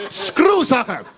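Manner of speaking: high, defiant rejection; mock indignant outburst. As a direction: throw it out as a punchline protest. Rest of world's final outburst.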